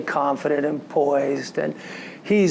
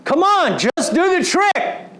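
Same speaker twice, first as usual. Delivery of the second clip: much higher, louder exasperated push; frustrated father; 13 semitones higher, 4 dB louder than usual. Retold or imitated. Dad losing patience with his son stuck in snow, exasperated. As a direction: snap at him like an impatient dad. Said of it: "I realize I sound like my father".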